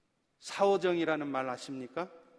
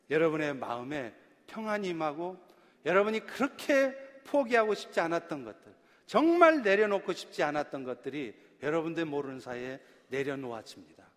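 ordinary talk, nothing marked

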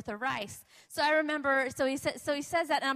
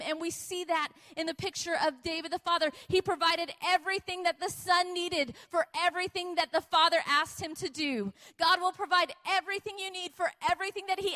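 impassioned sermon, building higher and more emphatic; fervent preaching; 3 semitones higher, 3 dB louder than usual. Acted. Preacher assuring the congregation that God will provide, fervent. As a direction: drive each promise home with conviction.

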